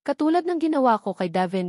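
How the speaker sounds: plain reading voice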